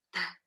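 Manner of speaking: TÁ as a bare T burst, hardly any vowel; isolated phonic T; letter sound. Shown as a demonstration. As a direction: release just the T sound, very short. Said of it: "o som da letra".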